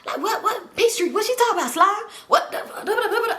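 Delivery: high mock fussing that runs into gibberish; mumbling, fussing homeboy. Homeboy fussing about what she said, high garbled mumbling. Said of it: "his homeboy still just mumbling, fussing".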